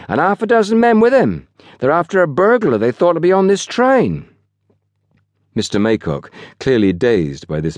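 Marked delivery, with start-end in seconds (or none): 0.1-4.2 put-on high-pitched, self-important young porter. A narrator's reading. Young porter briefs his boss on the police, importantly. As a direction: pass on big news with relish. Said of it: "said Wally importantly".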